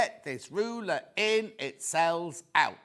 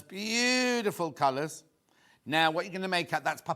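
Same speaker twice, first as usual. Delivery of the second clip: usual speaking voice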